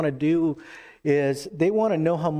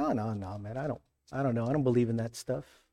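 low, soft, hurried brush-off; embarrassed disowning; 5 semitones lower, 7 dB quieter, faster than usual. Acted. Believer put on the spot denying faith, quiet embarrassed backpedalling. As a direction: back away from it under your breath.

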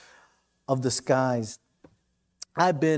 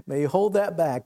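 ordinary talk

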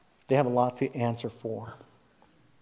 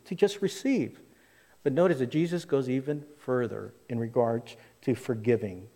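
ordinary talk, nothing marked

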